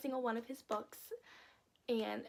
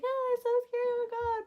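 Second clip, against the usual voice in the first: high, loud fake-frightened wail; hammed-up fright, pitched way up; mock scared crying; 13 semitones higher, 10 dB louder than usual. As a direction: put on an over-the-top scared whine. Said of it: "not even, like, crying".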